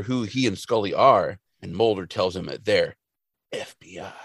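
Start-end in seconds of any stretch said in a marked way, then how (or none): 3.5-4.1 dramatic whisper; hushed reveal. Retold or imitated. Mulder telling Moe who they are, conspiratorial whisper. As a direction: whisper it like a secret identity.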